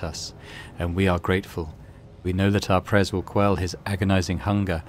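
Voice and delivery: lightly voiced monotone mutant priest.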